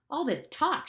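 high, angry outburst; shout. Acted. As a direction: snap at the whole meeting. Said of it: "shouted Ralph".

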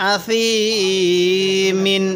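আসিমিন recited very slowly with tanween; tanween recitation. Shown as a demonstration. Teacher recites the full tanween form, slowly. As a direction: stretch আসিমিন like chanted Quran. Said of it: "তানবিনের উচ্চারণ বাংলায় দন্তান্ন".

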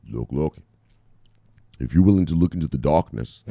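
a light, low ominous tone; a mock doom-laden teaser; darkness voice. Streamer teasing a programmer's theory, in a light mock darkness voice. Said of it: "I make that darkness voice".

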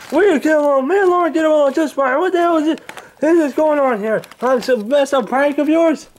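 put-on high, loud, cranky old man yelling; Angry Grandpa voice. Old man woken by pranksters, high cranky yelling. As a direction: bark at the intruders like a furious grandpa.

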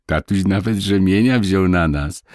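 usual speaking voice

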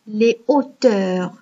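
"les auteurs" said slowly with no z before "auteurs"; no liaison. Shown as a demonstration. Teacher showing pupils an aspirated h, slow, no liaison. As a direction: keep "les" and "auteurs" apart, no z sound. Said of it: "Est-ce que j'ai fait la liaison? Non".

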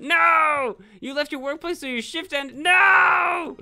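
loud, high, anguished shout of no; yelling at the game in despair; real shout at the game ending his shift; real shout of dismay. Real, not acted. Shift ended by accident in game.

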